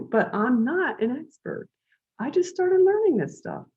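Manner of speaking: high, panicky objection; flustered protest. Retold or imitated. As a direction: squeak out the protest. Protesting to boss in retold scene.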